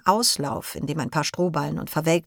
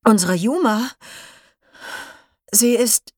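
higher, halting, hesitant announcement; shaken bad news; 5 semitones higher, 4 dB louder than usual. Leo's father tells the class Yuma is gone, halting and shaken. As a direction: force out terrible news in pieces.

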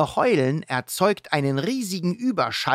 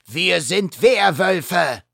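slow mock roar of a werewolf kid; 6 semitones higher, 4 dB louder, slower than usual. Werewolf kid Kevin introducing his pack, roaring slowly. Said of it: "brüllt ein dritter Werwolf".

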